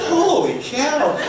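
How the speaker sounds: drawn-out, high, laughing holy cow; amazed exclamation. Retold or imitated. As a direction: stretch it out in laughing disbelief. Amazed at quick starter repair.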